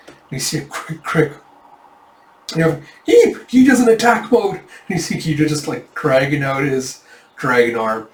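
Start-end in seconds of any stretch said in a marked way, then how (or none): 3.1-3.5 a very high, loud squeak of fright; high startled squeak. Acted. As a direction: squeak in sudden alarm.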